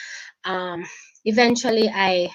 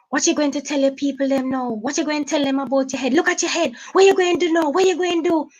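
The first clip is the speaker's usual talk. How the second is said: retold bully's jeering questions; retold angry taunting; 6 semitones higher than usual. Abuser taunting her in car.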